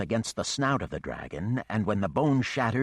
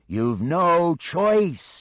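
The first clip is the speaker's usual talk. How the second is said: slow, much higher, louder shout; yell; 11 semitones higher, 9 dB louder, much slower than usual. Acted. John Drowse yells to Mischief that he has no choice. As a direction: shout it firmly to push him on. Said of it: "John Drowse yelled".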